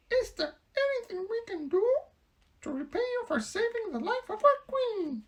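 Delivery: grateful mouse. Acted. Biggest mouse offering thanks to the Woodman, high squeak. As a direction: offer help like a humble mouse.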